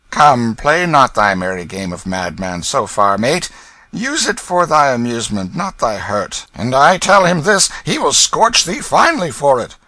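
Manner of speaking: a rogue talking sense to a madman; Hugo the thief. Acted. Hugo warns the king.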